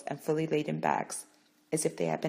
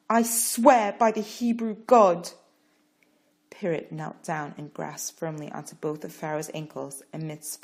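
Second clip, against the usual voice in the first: solemn vow; 5 semitones higher, 7 dB louder than usual. Acted. Parrot's vow to Pharaoh.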